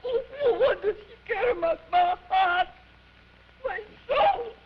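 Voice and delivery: high, loud theatrical wail; anguished lament. Acted. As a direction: bemoan it like a tragic diva. Singer laments her cruel impresario.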